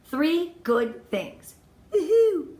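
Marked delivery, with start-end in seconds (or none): 1.9-2.5 cheer. Real, not acted. Cheering after list item.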